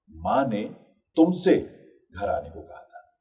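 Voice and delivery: single out तुमसे between two breaks; stress on तुमसे. Shown as a demonstration. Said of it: "यानी तुमसे कहा था, किसी और से नहीं".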